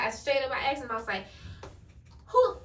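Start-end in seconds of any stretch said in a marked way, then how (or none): 2.3-2.6 a high, loud, piercing demand; sharp demand. Retold or imitated. To the boyfriend, demanding who, shrill and loud. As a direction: snap the question at him. Demanding name of other girl.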